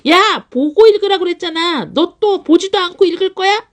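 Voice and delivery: high-pitched exasperated mom nagging; scolding mom demo. Shown as a demonstration. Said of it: "제가 직접 해보니까 아시겠죠". (아이를 다그치는 엄마 잔소리 말투)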